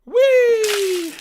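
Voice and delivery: very high, loud joyous shout; a girl playing with the hose; gleeful shriek; whee. A narrator's reading. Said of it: "she giggled".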